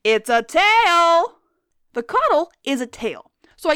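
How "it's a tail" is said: slow, loud triumphant announcement; excited reveal. Acted. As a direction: drag it out like a big reveal.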